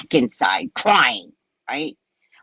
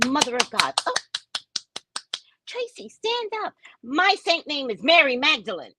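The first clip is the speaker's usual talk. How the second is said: much higher, sing-song church roll call; mock priest calling; 10 semitones higher than usual. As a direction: ham up the pompous announcer. Mocking church ceremony roll call.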